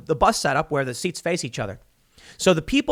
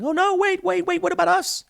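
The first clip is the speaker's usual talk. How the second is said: mock panic. Panicking mockers voiced in analogy.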